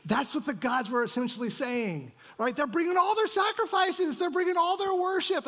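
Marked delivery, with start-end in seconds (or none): 2.6-5.5 much higher, eager pitch; high, eager preaching lift; excited build. Acted. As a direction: climb up high as the worship pours in. Worshippers bringing sacrifices.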